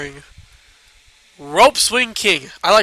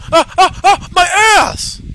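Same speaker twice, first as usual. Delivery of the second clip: high yelps of pain; screaming while falling; 11 semitones higher, 6 dB louder than usual. Acted. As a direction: yell like landing hard. Falling again in game.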